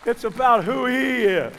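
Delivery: preacher at full force declaring who God is; preaching shout. Real, not acted. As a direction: shout it out to the congregation. Preacher's climax line.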